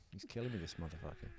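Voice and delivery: ordinary delivery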